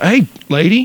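high, mock calling voice; hawk calling out. Host playing hawk to the woman, calling out. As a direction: pitch it up to get her attention.

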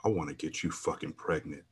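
low, hushed into her ear; whispered. A narrator's reading. Trainer whispering in her ear, hushed and low. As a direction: breathe it softly into her ear. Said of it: "whispered in my ear".

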